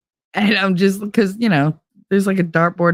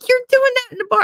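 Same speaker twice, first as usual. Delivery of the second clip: high mock scolding; faster than usual. Principal's retold complaint.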